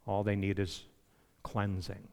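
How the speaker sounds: Jesus gently correcting Peter; kind and gracious. Retold or imitated. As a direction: gently reassure an eager friend. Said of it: "kindly and graciously said to Peter".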